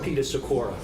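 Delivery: ordinary talk, nothing marked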